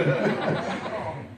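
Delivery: high, bright burst of laughter. Real, not acted. Laughing after wife's quote.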